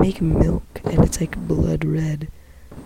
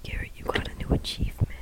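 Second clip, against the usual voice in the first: fast, soft whisper; 8 dB quieter, much faster than usual. Real, not acted. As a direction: whisper it quickly. Whispering to friend.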